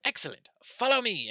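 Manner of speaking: high, fast gnome stammering; flustered gnome. Acted. Gnome messenger stammering a message, high and squeaky. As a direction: squeak and stumble like a nervous gnome. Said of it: "a high squeaky voice".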